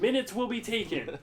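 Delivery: high, loud declaration; emphatic mock proclamation. Cook explaining burger wait to co-host, emphatic proclamation. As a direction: proclaim it with exasperated force.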